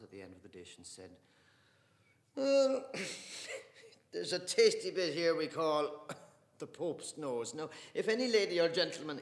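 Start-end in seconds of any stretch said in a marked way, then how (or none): 2.3-9.2 loud, high mock-ceremonious offer; teasing carver. Father offering the Pope's nose to the table, mock-grand.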